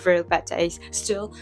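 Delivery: ordinary delivery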